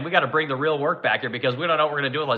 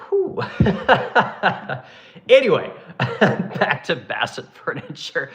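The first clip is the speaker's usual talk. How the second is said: laughing it off; slower than usual. Real, not acted. Laughs while returning to topic.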